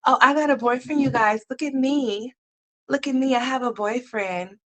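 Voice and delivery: high, sing-song mock of a giddy girl bragging; mocking girly brag. Vlogger mocking the ex bragging about her boyfriend, high girly mock voice. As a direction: squeal it like a smug little girl showing off. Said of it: "Like she wants us to know".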